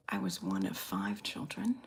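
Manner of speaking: normal talking voice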